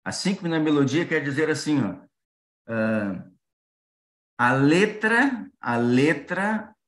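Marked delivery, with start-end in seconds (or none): 4.4-6.8 slow, drawn-out a letra; stressed slow. Shown as a demonstration. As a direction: stretch a letra slowly, stress on le. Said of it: "deve estar com a mesma acentuação".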